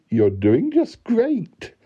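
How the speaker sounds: high, drawn-out mock praise; teasing encouragement. A narrator's reading. Lady to the man hunting her tattoo, teasing encouragement. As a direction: egg him on slowly in a high voice.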